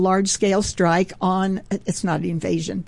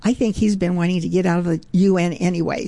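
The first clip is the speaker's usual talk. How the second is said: normal talking voice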